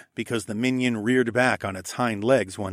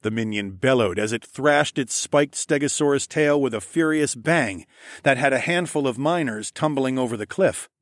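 ordinary delivery, nothing marked

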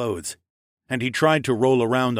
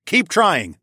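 loud, higher-pitched command; urgent raised-voice command; firm order; 8 semitones higher, 5 dB louder than usual. A narrator's reading. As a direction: order them on over the fight. Firm order in mid-battle.

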